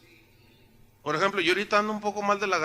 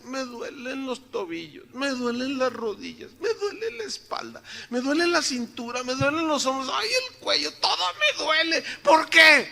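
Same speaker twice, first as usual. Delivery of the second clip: put-on complaining old woman; 6 semitones higher than usual. Ninety-year-old sister lists her aches to the pastor, hammed-up wail. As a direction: imitate an old lady wailing through her list of pains. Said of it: "no sé, noventa años".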